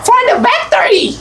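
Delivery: high-pitched "For the victory!"; playful battle cry. Shown as a demonstration. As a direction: chant it high for someone to copy. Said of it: "Gayahin mo yung boses ko". (mataas at masiglang sigaw)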